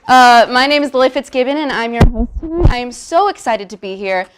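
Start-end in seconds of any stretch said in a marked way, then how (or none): none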